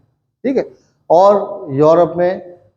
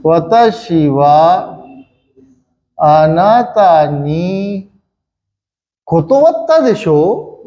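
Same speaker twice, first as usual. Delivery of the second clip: 1 slow dictation; much slower than usual. Shown as a demonstration. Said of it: "चलिए पूरा लिख देता हूँ".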